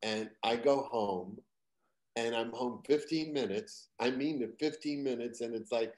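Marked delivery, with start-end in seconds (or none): none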